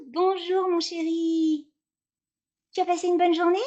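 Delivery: mother greeting her son at the school gate, high and sweet; sweet mum greeting. Acted. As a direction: greet him sweetly, drawing out mon chéri.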